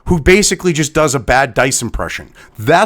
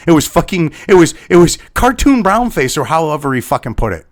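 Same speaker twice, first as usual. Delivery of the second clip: normal talking voice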